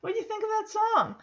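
dad coaxing his sad daughter sweetly. Retold or imitated. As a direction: coax a sulking kid gently. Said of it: "and asks".

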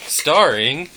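loud, high show host; announcer voice. Acted. Podcast intro announcement.

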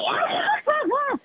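high squealing laugh; ticklish laugh. Real, not acted. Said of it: "don't tickle me".

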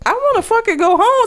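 mock terrified whine. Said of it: "he was so terrified".

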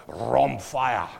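"rhomphia" slow, with a forceful push; powerful rhomphia. Shown as a demonstration. Pastor shows congregation the word's power, "rhomphia" slow and forceful. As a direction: make "rhomphia" weighty and drawn out. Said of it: "Do you get that power of that word?".

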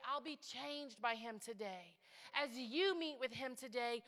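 ordinary delivery

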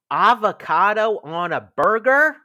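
high, loud disbelief rising on burger; emphatic outraged quote. Retold or imitated. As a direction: read it like six exclamation marks. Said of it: "I hope I said that with enough emphasis".

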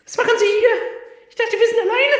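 squeaky high-pitched stranger; high character voice. Acted. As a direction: pitch way up, surprised greeting. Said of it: "Das sind Irma und Heinz".

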